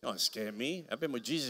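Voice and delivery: ordinary talk, nothing marked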